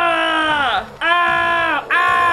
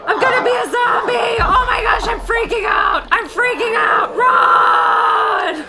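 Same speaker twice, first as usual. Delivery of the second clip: panicked screaming; 5 semitones higher than usual. Acted. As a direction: scream like you're turning. Bitten and turning into zombie.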